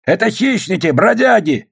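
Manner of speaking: high-pitched accusing call; denouncing shout. A narrator's reading. Sergeant egging a soldier on, high accusing shout.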